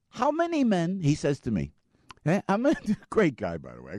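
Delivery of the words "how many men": high mock Pakistani accent. Driver challenging passenger, in a mock Pakistani accent. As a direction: imitate a Pakistani driver, pitched up.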